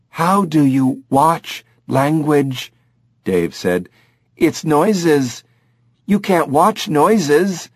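literal-minded chimp, slowly; puzzled chimp. Acted.

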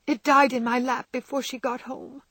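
put-on a girl's tearful confession; crying with a sob. A narrator's reading. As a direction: sob out the words as a distressed girl. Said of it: "cried Beth with a sob".

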